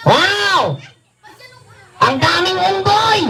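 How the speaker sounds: put-on high-pitched puppet child voice; puppet kid voice. Ventriloquist's puppet boy gawks at the crowd, high-pitched.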